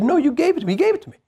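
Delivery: high, indignant insistence; insistent protest. Retold or imitated. Son arguing toe to toe with his dad, insistent and high. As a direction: push back hard, pitch climbing. Heated argument with dad over camera.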